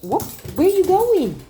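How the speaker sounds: high, loud startled call to the dog; surprised high call. Real, not acted. Trainer calling to her wandering dog, startled and high.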